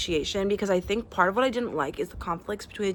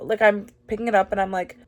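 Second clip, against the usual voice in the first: ordinary talk, nothing marked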